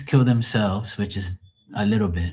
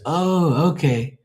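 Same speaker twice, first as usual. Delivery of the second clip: retold realization; 8 semitones higher, slower than usual. Retold DJ finally understands.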